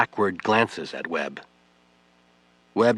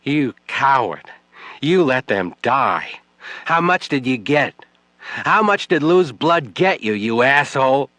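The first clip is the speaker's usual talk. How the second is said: angry accusation; 5 semitones higher, 5 dB louder than usual. Acted. Widow accusing Webb of selling out her husband, furious. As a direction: hammer each accusation home.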